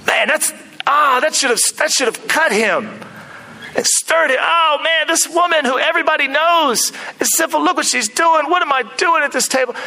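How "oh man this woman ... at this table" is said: dismayed realization. Acted. Simon realizing his shame.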